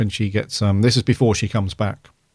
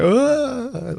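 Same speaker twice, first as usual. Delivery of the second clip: mock wail; 14 semitones higher than usual. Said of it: "She wasn't like".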